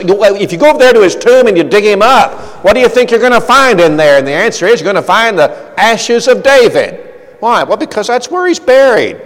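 preacher pushing the crowd to picture the open tomb, fast and loud; fervent preaching. Acted. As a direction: drive the question home to the congregation. Preacher presses the point.

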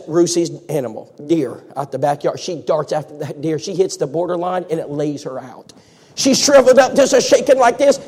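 ordinary delivery

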